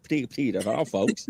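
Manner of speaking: stuttering cartoon sign-off; Porky Pig. Storyteller covers a tongue-tie with a joke, stuttering cartoon voice. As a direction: stutter the repeated syllable, joking through it. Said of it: "It sound like Porky the Pig".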